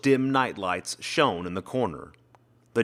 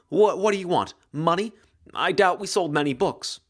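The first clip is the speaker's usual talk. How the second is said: frightened questions; 6 semitones higher than usual. A narrator's reading. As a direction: stammer out the questions in alarm. Frightened woman questions intruder.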